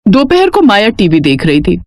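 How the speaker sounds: plain reading voice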